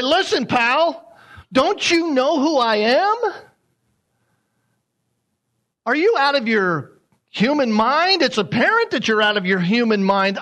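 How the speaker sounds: indignant angel pulling rank, pitched high; Gabriel. Acted. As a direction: speak down in disbelief to a doubting human. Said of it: "trying to figure out there what kind of voice he said that".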